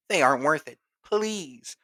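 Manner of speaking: put-on pleading girlfriend.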